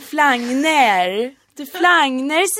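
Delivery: "flagner" with a bright open a and a rising end, Hälsinge style; Hälsinge dialect. Shown as a demonstration. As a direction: say "flagner" the Järvsö way, ending in -er. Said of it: "Ja, med E". ("flagner" på hälsingemål)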